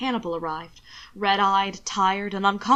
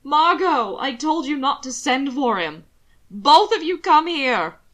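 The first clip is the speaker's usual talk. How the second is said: louder, higher sharp reproach; angry scolding; 4 semitones higher, 6 dB louder, slower than usual. Acted. Alana in labor scolds Margot, loud and high. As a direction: scold them both from the bed.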